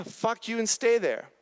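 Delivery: ordinary talk, nothing marked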